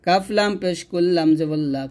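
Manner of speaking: ordinary delivery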